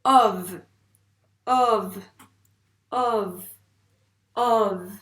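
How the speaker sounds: "Ov" loud, with a buzzed final v; loud, careful dictation of "Ov"; dictated syllable. Shown as a demonstration. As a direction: punch the open o of "Ov" and hold the v.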